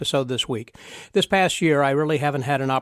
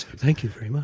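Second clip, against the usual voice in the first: radio voice. Retold or imitated. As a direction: mimic his radio voice lightly. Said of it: "You sound like a radio voice".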